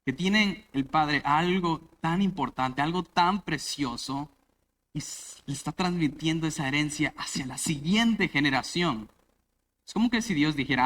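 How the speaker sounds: ordinary talk, nothing marked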